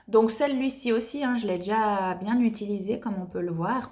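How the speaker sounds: usual speaking voice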